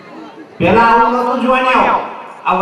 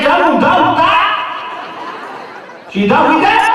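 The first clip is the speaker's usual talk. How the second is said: higher, laughing call of a brusque doctor; bossy doctor; 6 semitones higher than usual. Retold or imitated. Doctor tells the patient to flip over, higher and laughing. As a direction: order him to turn over, pitched up.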